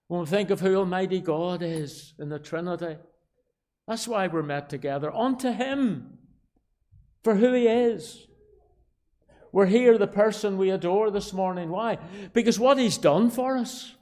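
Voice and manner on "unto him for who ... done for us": high, emphatic pulpit proclamation; raised preacher's voice; fervent preaching. Real, not acted. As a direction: drive each answer home to the congregation. Preaches at full force.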